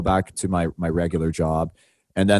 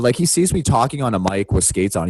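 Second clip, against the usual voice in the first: ordinary talk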